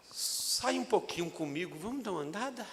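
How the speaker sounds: soft, gentle invitation; gentle Jesus. Acted. Jesus inviting Peter for a walk, softly. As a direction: invite him quietly and kindly. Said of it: "E o Senhor falou assim".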